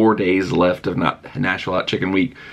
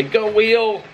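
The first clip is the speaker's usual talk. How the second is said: high, bright cheer for the spinning wheel; excited cheer; 12 semitones higher than usual. Real, not acted. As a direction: call out to the wheel with a burst of excitement.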